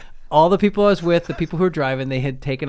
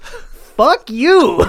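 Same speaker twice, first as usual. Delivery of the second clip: much higher and louder, offended snap; mock angry retort; 14 semitones higher, 13 dB louder than usual. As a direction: play the insulted driver barking back. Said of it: "when I freaked out on them, they're just like".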